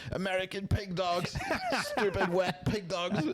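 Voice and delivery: sing-song mocking taunt, slowed with a laugh; laughing, singsong pig dogs taunt; mock angry extra. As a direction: jeer it like a gloating boatman. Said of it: "he's like".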